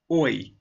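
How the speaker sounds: "Oi" starting forward, then going back and wide; slow, exaggerated glide on "Oi"; teacher models the diphthong "Oi"; oi sound. Shown as a demonstration. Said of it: "Your mouth needs to do a lot of work for this".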